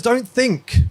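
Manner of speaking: high, punchy mock Bruce Lee order; Bruce Lee command. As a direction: snap it like a kung fu master. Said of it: "He goes".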